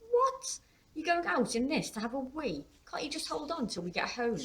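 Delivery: high, loud 'what?' into protest; incredulous daughter. A narrator's reading. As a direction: object in a high, disbelieving voice. Daughter incredulous at dad's plan.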